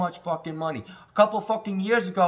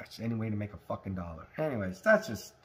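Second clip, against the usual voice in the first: ordinary talk, nothing marked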